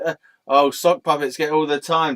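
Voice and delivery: ordinary talk, nothing marked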